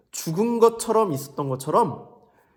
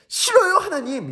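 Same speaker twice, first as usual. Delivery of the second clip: much higher and louder, whining no; whiny Jonah; 13 semitones higher, 5 dB louder than usual. Acted. Jonah refusing God's command, whiny. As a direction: refuse like a stubborn child. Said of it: "이러고".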